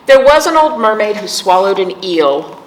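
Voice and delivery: ordinary talk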